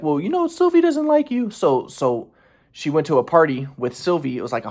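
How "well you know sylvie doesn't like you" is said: high mocking falsetto; put-on girlfriend voice, pitched way up; imitating girlfriend. Girlfriend turning down his request to come, in mock falsetto. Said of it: "And she's like".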